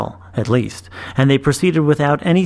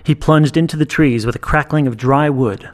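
usual speaking voice

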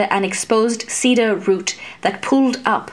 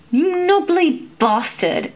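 much higher, heated insult; angry squire advancing on the creature; growled insult; 9 semitones higher than usual. A narrator's reading. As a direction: spit the insult out. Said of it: "Alex growled".

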